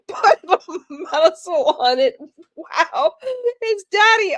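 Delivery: loud, high words bursting through real laughter; can't stop laughing. Real, not acted. Streamer reading a viewer's muscle joke, laughing helplessly. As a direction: read it out while still cracking up. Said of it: "I can't stop laughing".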